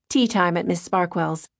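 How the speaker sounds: ordinary delivery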